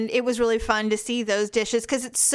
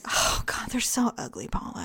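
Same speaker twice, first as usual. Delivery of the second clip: disgusted hush; 6 dB quieter than usual. Real, not acted. Disgusted aside about food photos.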